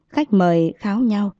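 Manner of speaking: usual speaking voice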